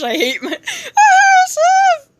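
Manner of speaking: very high, slow laughing wail; helpless laughter. Real, not acted. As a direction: squeal it through real laughter. Laughing at own misreading.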